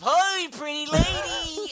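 loud, high, drawn-out heckler caricature; wife's Comic-Con impression. Husband relaying his wife's impression of Comic-Con crowds, loud high caricature. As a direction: shout it high and grotesque, stretching the words.

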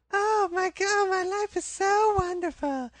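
very high, loud, airy mock-youth voice; sing-song parody of spoiled students; mocking carefree college kid. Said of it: "he and I go".

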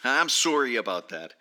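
Canadian "sorry", said SORE-ee; mock Canadian accent. Joking apology to co-hosts, mock Canadian "sorry". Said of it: "you're sounding Canadian".

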